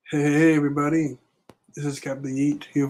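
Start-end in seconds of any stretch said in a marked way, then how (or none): none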